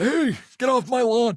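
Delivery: put-on yelling old man, high and loud; grumpy old man. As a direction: shout it like a cranky old-timer. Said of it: "I do sound like, like an old guy".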